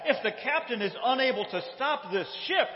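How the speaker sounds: high, outraged tirade climbing as it goes; hammed-up haughty indignation; indignant shout back. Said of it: "she shouts back".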